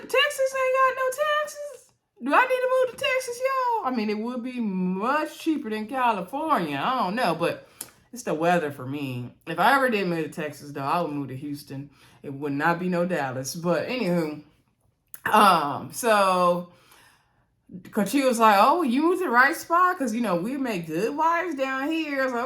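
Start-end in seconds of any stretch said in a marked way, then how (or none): 0.0-3.9 mock incredulous outburst. Recapper reacting to news of no taxes in Texas, mock incredulous. 18.6-22.2 higher, sing-out flirty pitch; lightly acted flirty woman. Recapper voicing Cochia pitching herself to a newcomer, lightly flirty.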